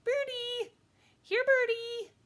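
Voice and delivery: put-on very high, loud little-kid call; high-pitched small child calling out; toddler calling. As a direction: call the birds like an excited toddler. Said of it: "he called".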